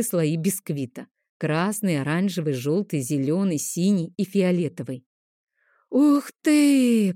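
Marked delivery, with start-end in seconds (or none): 5.9-7.1 much higher and louder amazed gasp; delighted wow. Acted. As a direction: kids wowed by a rainbow cake. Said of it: "восхищённый вздох".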